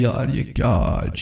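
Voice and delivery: low, slow bystander murmur; a guard filming, half amused. Retold or imitated. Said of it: "video بنا رہے ہیں". (نیچی، دھیمی حیران آواز)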